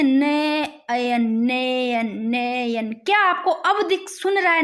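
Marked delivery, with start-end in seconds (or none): 0.0-2.9 "नयन" drawn out slowly, sound by sound. Shown as a demonstration. Teacher showing students the hidden sound, "नयन" sounded out slowly. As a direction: stretch each syllable of "नयन". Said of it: "इसमें बोलना आप sound से".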